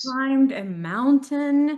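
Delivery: climbed a mountain stretched slow and loud; slow emphasis. Real, not acted. Friend praising the birthday climb, slow and loud. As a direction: lean on each word with awe. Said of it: "emphatic praise".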